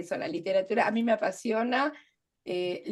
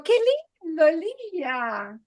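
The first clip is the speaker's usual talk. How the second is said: sour, sarcastic qué lindo el día, pitched higher; negative irony; 7 semitones higher, 4 dB louder than usual. Shown as a demonstration. Teacher contrasting tones for students, sarcastic. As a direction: sneer at the weather. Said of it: "La anterior era connotación negativa".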